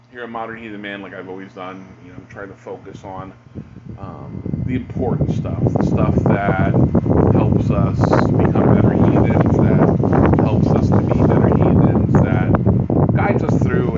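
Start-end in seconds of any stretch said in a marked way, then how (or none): 4.7-14.0 forceful, slow, loud delivery; loud emphatic. Real, not acted. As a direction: push it out with conviction. Stressing what helps heathens grow.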